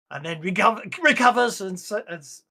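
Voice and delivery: ordinary talk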